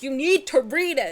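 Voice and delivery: loud insistent order; mock forceful demand. Bully grabbing a small kid by the shoulder, loud demand. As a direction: lean in hard on each word. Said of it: "She grabbed me and she was like".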